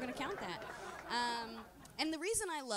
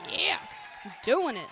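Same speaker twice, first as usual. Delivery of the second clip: gleeful; 12 dB louder than usual. Retold or imitated. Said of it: "it was like".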